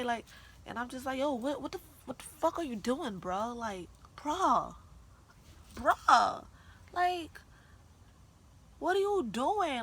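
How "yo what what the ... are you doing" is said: high, loud disbelieving protest; reenacted outburst at a boyfriend; exasperated confrontation. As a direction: draw out each bruh in exasperation. Acting out her upset confrontation.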